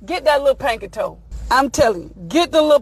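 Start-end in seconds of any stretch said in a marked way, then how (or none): none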